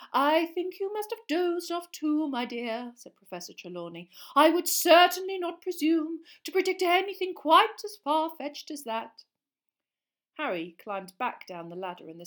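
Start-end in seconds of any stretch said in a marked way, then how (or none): 0.1-9.2 put-on airy old seer. Trelawney voiced.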